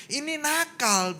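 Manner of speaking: high, drawn-out complaint; grumbling at God. Retold or imitated. Speaker tells God who is naughty, high grumbling complaint. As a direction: tattle on someone to God, stretching it out. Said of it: "saya mulai ngomel sama Tuhan".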